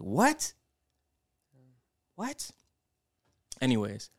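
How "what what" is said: high-pitched, rising "what?"; retold disbelief. Telling how they reacted to the text about the aunt, retold disbelief. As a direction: re-enact a stunned double take, voice jumping way up. Retelling reaction to aunt's death news.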